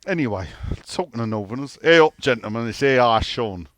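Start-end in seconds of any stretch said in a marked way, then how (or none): 1.8-3.7 loud, hearty Yorkshire greeting; Yorkshire accent. Retold or imitated. Podcaster greeting listeners after talking of Northerners, loud Yorkshire accent. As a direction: belt it out in mock Yorkshire. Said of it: "give me a right Yorkshire accent".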